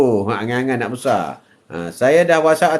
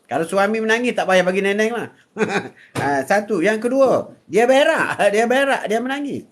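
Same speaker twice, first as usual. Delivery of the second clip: raised, sing-song preaching voice; 7 semitones higher than usual. Acted. Grandfather preaching to live viewers, raised and lively. Atuk joking about crying babies.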